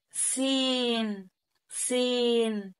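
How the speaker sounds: سين drawn out very slowly; teacher stretching سين for the class; slow demo sound. Shown as a demonstration. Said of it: "شايف انا بلون لك الدنيا ازاي؟".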